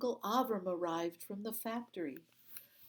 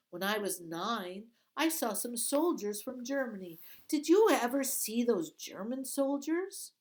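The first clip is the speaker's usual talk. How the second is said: high, loud, bright-eyed question; eager girl; 5 semitones higher, 7 dB louder than usual. Acted. Girl distracts the guard, eagerly high and loud.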